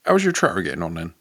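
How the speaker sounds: put-on quick rustic farmer accent; countryside accent. Countryman repeats his question to a puzzled friend, fast rustic accent. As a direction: rattle it off like an English country yokel. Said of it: "a genuine countryside accent".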